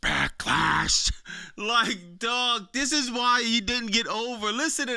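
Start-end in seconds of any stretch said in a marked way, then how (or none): 0.1-1.1 lightly imitated breathless promo delivery; low, mock out-of-breath announcement; Triple H. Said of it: "bro sounds so".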